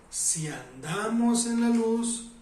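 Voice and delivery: "andamos" drawn out slowly, pitched high; stress on ANDAMOS. Real, not acted. Preacher setting walking against merely saying, stress on "andamos". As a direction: stretch and lift "andamos". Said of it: "no si decimos, sino".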